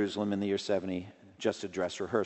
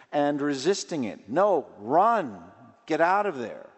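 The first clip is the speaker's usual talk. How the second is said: raised, ringing sermon voice, higher and louder; preaching at full force; 5 semitones higher, 5 dB louder than usual. Acted. Pastor urging the congregation to flee temptation, preaching loudly. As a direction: drive it home from the pulpit.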